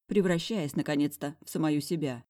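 plain reading voice

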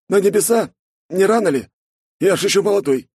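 put-on high, loud playful young guy; joking buddy voice. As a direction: tease like a cheeky friend. Snegir joking.

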